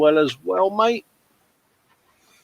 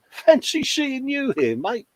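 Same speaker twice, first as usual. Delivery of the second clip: pitched way up in pretend surprise; playful fake astonishment; mock surprise; 10 semitones higher, 4 dB quieter than usual. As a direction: act delighted to bump into him. Greeting a returning viewer.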